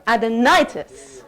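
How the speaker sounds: "Adenitis" slowly, NI stretched and punched; stress on NI. Shown as a demonstration. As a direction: lean hard on the NI of "Adenitis". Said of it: "We're gonna stress on the ni".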